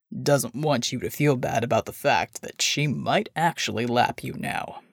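low and unhurried, like a big man; deep gruff voice. Acted. Bull continues speaking.

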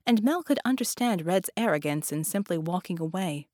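usual speaking voice

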